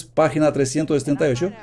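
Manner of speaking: ordinary talk, nothing marked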